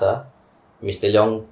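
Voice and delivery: normal talking voice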